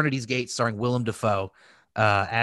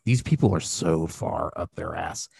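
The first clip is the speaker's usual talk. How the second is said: low, sneering mock of a snob; scoffing quote; 7 semitones lower than usual. Friend recalls cohost mocking a dance show, low sneer. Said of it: "I just remember you being like".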